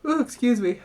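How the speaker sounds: words through a yawn; a real yawn swallowing the words. Real, not acted. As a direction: stretch it out mid-yawn. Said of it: "sorry that I just yawned".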